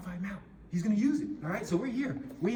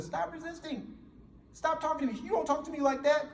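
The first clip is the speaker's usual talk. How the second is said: higher-pitched angry barking at the suspect; angry officer; 10 semitones higher than usual. Pretend. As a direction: snap at him like a cop losing his temper. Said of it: "as opposed to".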